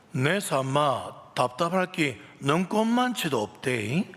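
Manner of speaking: slow, drawling Gyeongsang dialect reading of the psalm; a lightly voiced Gyeongsang dialect recitation. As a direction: read the psalm like a Gyeongsang local, unhurried and blunt. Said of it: "먼저 경상도 버전입니다".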